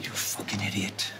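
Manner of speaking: hushed, unvoiced curse at his friend; furious whisper aside; whispered insult. Real, not acted. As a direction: whisper it so she can't hear.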